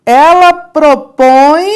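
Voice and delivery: Ela propõe slow and loud, stress on Ela; new example emphasized. Shown as a demonstration. Teacher loudly introduces the next example sentence. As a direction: open with a punched Ela propõe. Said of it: "É só isso que eu vou fazer".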